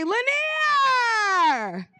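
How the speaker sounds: high, long-drawn name swooping down; calling out. Real, not acted. Host calling her good sis up on stage, stretched-out holler. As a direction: holler the name across the room.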